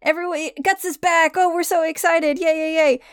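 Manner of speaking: high, excited group cheer; cheering crowd. Acted. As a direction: welcome a friend back with mock jubilation. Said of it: "everyone is like".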